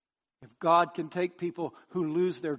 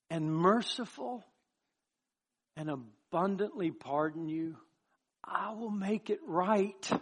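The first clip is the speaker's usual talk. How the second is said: slow, stretched words, lifting higher toward the end; delighted tender promise; 4 semitones higher, slower than usual. Acted. Preacher voices God promising mercy, slow and delighted. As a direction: promise it lovingly, letting each key word linger. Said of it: "now God can say delightfully".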